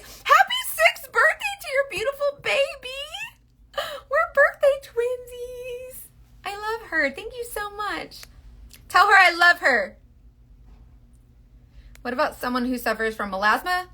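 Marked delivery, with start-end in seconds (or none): 0.0-6.0 very high, excited squeal; gushing birthday wish. Real, not acted. Host wishing a viewer's baby happy birthday, high excited gushing. 6.4-10.0 high, cooing affection; affectionate gushing. Real, not acted. Host sending love to a viewer's little girl, high gushing.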